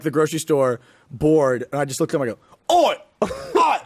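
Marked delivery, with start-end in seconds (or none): 2.7-3.8 high mock-British babble; fake British gibberish. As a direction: childhood nonsense language in a hammed-up British accent. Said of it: "we called it being British".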